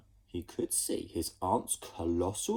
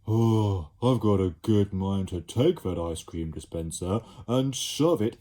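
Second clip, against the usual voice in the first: louder, fed-up creature voice; exasperated; 6 dB louder than usual. Acted. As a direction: Slime about to lose it. Slime fed up.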